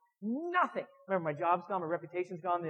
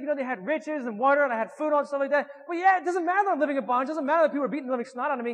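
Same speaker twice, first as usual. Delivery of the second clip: higher and louder, talking himself into it; hammed-up excited self-justifying rant; mock rationalizing rant; 5 semitones higher, 5 dB louder than usual. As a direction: argue like someone longing to go back to the past. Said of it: "in my head, I'm like".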